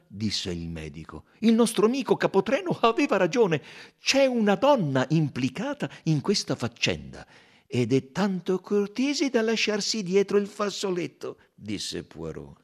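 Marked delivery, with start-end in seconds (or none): 1.4-11.4 raised, louder, brisk detective voice; amused Belgian sleuth pointing out the clue; Poirot's voice. A narrator's reading. Poirot to the doctor about the handkerchief, brisk and raised.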